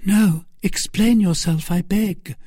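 high-pitched, begging Milady voice; pleading Milady. Acted. Milady begging Felton to explain, high-pitched. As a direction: entreat him in a high voice. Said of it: "asked Felton".

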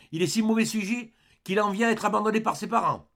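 high, indignant rebuke; scolding. A narrator's reading. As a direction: exclaim in gruff disapproval. Ursus scolds the abandoned boy.

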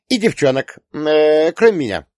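put-on high, loud hesitant girl; teasing daughter. A narrator's reading. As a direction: falter over the exception, pitched high. Daughter jokingly exempts herself. (высоким голосом дочки, с шутливой заминкой)

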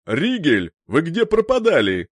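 loud call over the comm; shouting. A narrator's reading. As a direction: shout it through a radio. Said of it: "неожиданно крикнул мужской голос".

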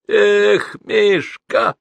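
high, loud wail of reproach; tearful whine. A narrator's reading. Weakened man reproaching Mishka, tearful voice. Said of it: "сказал он плаксивым голосом".

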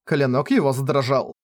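usual speaking voice

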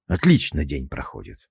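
gurgled through water, low; gurgling. Acted. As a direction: mutter it half underwater, bubbling. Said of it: "пробулькал я".

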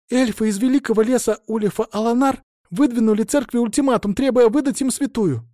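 high-pitched, urgent report; agitated novice. A narrator's reading. Novice reports the elves' ultimatum to the abbess, agitated. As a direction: blurt the alarming news in a high voice. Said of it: "взволнованный голос".

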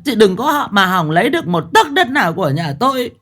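mother-in-law bursting in to berate her son's wife, shouting; shrill scolding. Acted. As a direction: tear into the daughter-in-law at full volume. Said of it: "quát lên ầm ĩ".

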